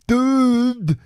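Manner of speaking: high, loud mocking dude; a hammed-up surfer-dude shout. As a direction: yell it like a teenage surfer. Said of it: "Who says dude?".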